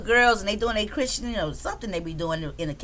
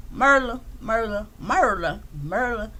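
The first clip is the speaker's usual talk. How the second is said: put-on Merla said again and again, softer each time, reaching for Rita's accent; trying Rita's accent; 3 semitones lower, 3 dB quieter than usual. Streamer trying to copy Rita's accent on Merla. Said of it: "so I can't quite carry, pull it off".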